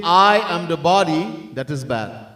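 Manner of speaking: ordinary delivery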